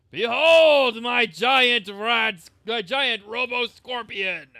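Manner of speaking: loud, slow, grand proclamation; triumphant shout. Acted. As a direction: bellow it like a conquering warlord. Riding giant robot scorpion into battle.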